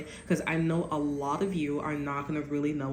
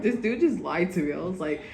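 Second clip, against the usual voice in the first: ordinary talk, nothing marked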